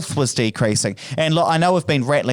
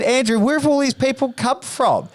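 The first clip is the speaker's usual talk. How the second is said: surprised; 10 semitones higher than usual. Retold or imitated. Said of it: "And I said".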